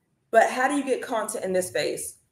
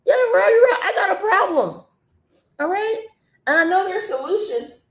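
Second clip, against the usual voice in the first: high, laughing mock voice of a buyer who gets it; mock customer voice, pitched way up, laughing and a bit louder; realizing buyer; 13 semitones higher, 3 dB louder than usual. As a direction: play the customer suddenly seeing the problem, giggling. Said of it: "They're like".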